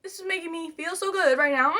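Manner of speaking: high, thin pretend happiness; mock tearful cheer. Younger self not wanting anyone to see tears, mock tearful cheer. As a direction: act happy through fake held-back tears.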